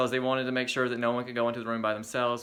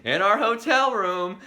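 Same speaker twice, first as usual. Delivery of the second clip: ordinary talk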